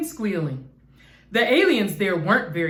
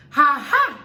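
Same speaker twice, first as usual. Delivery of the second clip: louder, higher taunting ha ha; mocking laugh; 5 semitones higher, 5 dB louder than usual. A narrator's reading. Martians mock the Snoogles, taunting laugh. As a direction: taunt the little ones. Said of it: "they cried".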